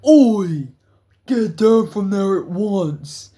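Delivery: loud, slow police bark from below; shouted order. Retold or imitated. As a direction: yell it like a guard ordering kids off the roof. Security guard yelling from below.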